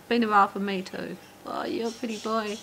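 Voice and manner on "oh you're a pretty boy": high, sweet praise for a bird; pet talk voice. Real, not acted. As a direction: coo at a budgie. Cooing at budgies.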